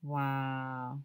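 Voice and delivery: plain reading voice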